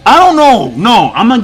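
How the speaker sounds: exasperated burst, loud and high at first; mad. Real, not acted. Reviewer refusing to rate the chapter, mad. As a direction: blurt it out in frustration. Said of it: "I'm just mad, bro".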